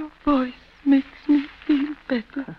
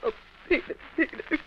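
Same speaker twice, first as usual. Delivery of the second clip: breathy near-whisper, softer; hushed plea; 4 semitones higher, 7 dB quieter, faster than usual. Acted. The hurt wife whispers to her husband, pleading. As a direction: plead his name under her breath. Wife distressed after the quarrel.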